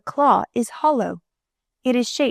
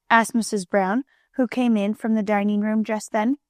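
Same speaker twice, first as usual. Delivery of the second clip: ordinary delivery, nothing marked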